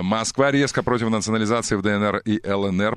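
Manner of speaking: usual speaking voice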